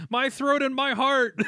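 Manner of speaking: lightly retold yell; half-hearted panicked cry; mild mock yell. JoJo yelling about his throat and heart, mild mock yell. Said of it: "JoJo yells".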